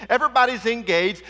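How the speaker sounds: ordinary talk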